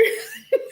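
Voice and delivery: very high shrieking laugh; squealing laugh. Real, not acted. At the couple's mess, a high laugh.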